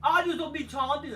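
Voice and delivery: high, loud mocking whine; high loud parody of a wrestler. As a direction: sneer it out like a whiny wrestler.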